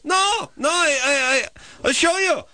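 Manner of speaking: put-on high shouted Israeli-accent caricature; insistent mock Israeli accent. Host mimicking her impulse to argue, mock Israeli accent. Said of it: "what is that impulse".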